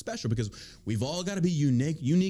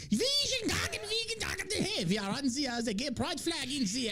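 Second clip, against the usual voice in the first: put-on high-pitched botched German accent slipping into French; French-sounding mock Nazi; 11 semitones higher than usual. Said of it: "my Nazis are French all of a sudden".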